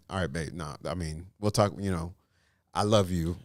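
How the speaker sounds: low, soft reassurance; hushed, calming boyfriend talk; gentle letdown. Acted. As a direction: let a partner down softly. Acts gentle letdown of proposing girlfriend.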